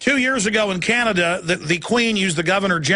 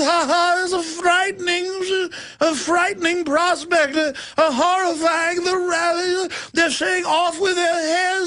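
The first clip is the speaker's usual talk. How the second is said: high, hammed-up aristocrat; mock royal voice; 8 semitones higher than usual. Host mocking royals cheering executions, high sneer. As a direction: stay in the pompous royal sneer.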